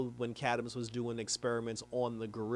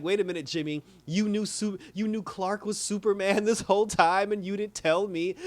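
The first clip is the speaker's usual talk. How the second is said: high, louder accusation; a retold upset demand; mock mad; 8 semitones higher, 5 dB louder than usual. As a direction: Lois calling Jimmy out, half-playful. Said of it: "Lois gets mad".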